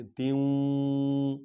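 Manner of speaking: تیوں very slow with a long nasal hum; nasal ghunna. Shown as a demonstration. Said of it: "نون غنہ کریں گے".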